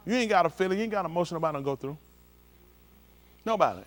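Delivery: normal talking voice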